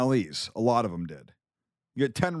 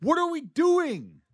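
very high-pitched, incredulous exasperation; exasperated outburst; 12 semitones higher than usual. Real, not acted. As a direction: protest the derailed topic, voice shooting up. Exasperated outburst at derailed discussion.

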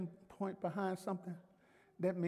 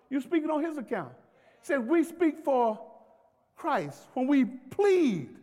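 raised, driving sermon delivery; emphatic preaching; 5 semitones higher, 6 dB louder than usual. Real, not acted. Preacher telling church to plead the case, emphatic preaching. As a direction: preach it with mounting force.

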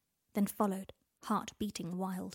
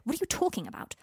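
demanding; 7 semitones higher, faster than usual. Acted. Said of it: "Harry demanded".

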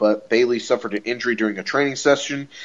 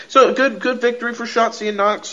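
"so good" said much higher, building excitement; excited praise; 9 semitones higher than usual. Real, not acted. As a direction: pitch jumps way up on "so good". Enthusing over the victory.

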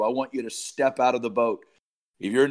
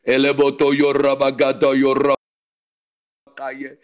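louder fervent outburst in tongues, last word slowly drawn out; 7 dB louder, slower than usual. Real, not acted. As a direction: cry the syllables out to God. Praying in tongues, crying out.

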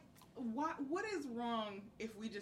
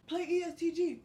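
shouting; 6 semitones higher, 5 dB louder than usual. Retold or imitated. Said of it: "one of the guys starts shouting".

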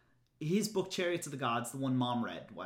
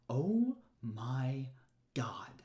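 whispered oh my god; 3 semitones lower, much slower than usual. Real, not acted. Overwhelmed by the confusing book.

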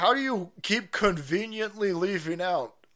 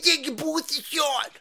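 much higher loud fluster; mocking officials; 12 semitones higher, 3 dB louder than usual. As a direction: falsetto panic, stretching the last words. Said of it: "came out like".

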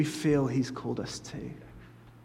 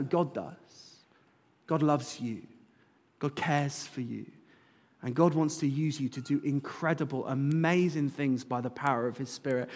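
ordinary talk

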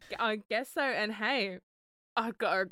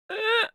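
high mock groan mid-tears; a hammed-up crying "Ugh"; mock ugly-cry groan; 11 semitones higher than usual. Showing cohost how she jokes while crying, high mock groan. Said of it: "and I'm go".

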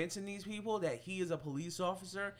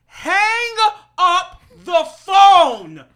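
shouting hang up the phone, much higher and louder; yelling at the story; 14 semitones higher, 20 dB louder, slower than usual. Real, not acted. Listener yelling at the victim in the story, loud frustrated shout. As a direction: yell at her to hang up in disbelief. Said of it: "I'm sitting here saying the entire time".

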